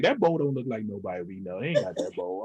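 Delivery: low, wary mock voice; low judgmental voice. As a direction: voice a suspicious guest, pitched low. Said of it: "you be like".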